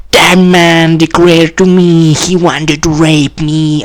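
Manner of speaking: high-pitched mock complaint; accusing woman caller. Woman phoning police to accuse him, high mock alarm.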